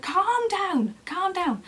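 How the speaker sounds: high, insistent command; firm urging. Retold or imitated. Midwife with needle telling patient to calm down, insistent urging. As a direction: push the words out high and firm.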